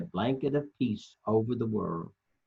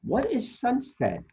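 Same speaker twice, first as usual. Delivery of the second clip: much higher, wide-eyed question; Lumpy; 12 semitones higher than usual. Acted. Storyteller voicing Lumpy asking about sunset, high. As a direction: ask high and wondering. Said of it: "asks Lumpy".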